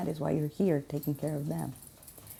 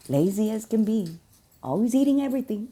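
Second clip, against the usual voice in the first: teasing boss; 6 semitones higher, 7 dB louder than usual. Retold or imitated. Voicing boss teasing his workers.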